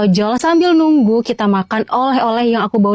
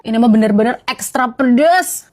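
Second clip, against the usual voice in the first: usual speaking voice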